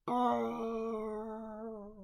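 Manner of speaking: high wailing cry, flying away; wolf crying out. Acted. Wolf blown away in a balloon, high wailing cry. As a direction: let out a long high yelp. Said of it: "with the wolf crying out".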